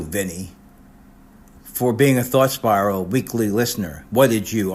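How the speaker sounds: normal talking voice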